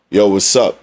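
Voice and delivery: low, bass-heavy "Yo, what's up"; deep greeting. Shown as a demonstration. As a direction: drop the voice into the chest. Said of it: "You can feel my voice".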